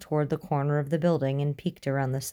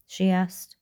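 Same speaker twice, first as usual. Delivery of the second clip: ordinary delivery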